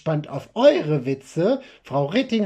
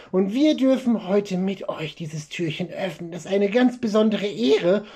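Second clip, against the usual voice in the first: ordinary delivery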